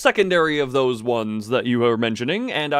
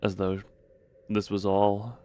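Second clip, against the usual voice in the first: story narration; 5 semitones lower, 5 dB quieter than usual. A narrator's reading. Reads game narration.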